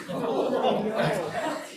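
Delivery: rising burst of laughter; a short real laugh before going on. Real, not acted. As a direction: laugh at the absurd moment. Laughing at the dog running in.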